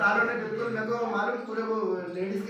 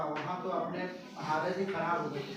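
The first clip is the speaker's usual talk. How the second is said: ordinary talk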